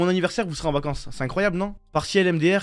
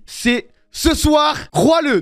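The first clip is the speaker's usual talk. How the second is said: mock big reveal; 6 semitones higher, much slower than usual. Streamer pranking viewers with a fake announcement, hyped. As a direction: stretch it out like a grand reveal.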